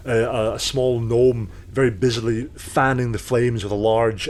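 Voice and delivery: ordinary delivery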